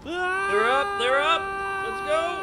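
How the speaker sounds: very high, loud thrill yell; excited scream. Real, not acted. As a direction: squeal it on the big drop. Screaming on roller coaster.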